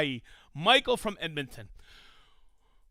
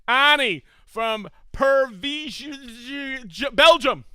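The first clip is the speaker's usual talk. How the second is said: drawn-out first try; 5 semitones higher, 8 dB louder, much slower than usual. Shown as a demonstration. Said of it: "Minnie, how do you say it?".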